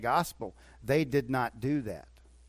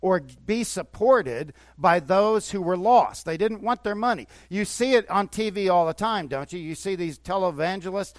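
usual speaking voice

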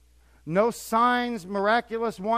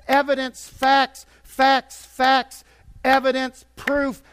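mock demanding shout; 5 semitones higher, 7 dB louder, slower than usual. Mocking people who demand proof.

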